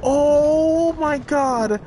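high, very drawn-out cry of shock; horrified exclamation. Real, not acted. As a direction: stretch it out in horror. Horrified by game father.

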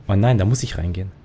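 ordinary talk

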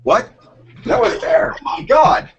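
laughing, high and loud; laughing shout. Real, not acted. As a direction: burst out laughing in embarrassment. Caught out about book title.

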